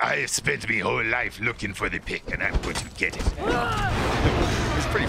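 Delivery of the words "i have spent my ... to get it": a hint of a creepy old obsessive; lightly voiced creepy old man. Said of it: "this old creepy fuck who's like".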